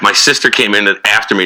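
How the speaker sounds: normal talking voice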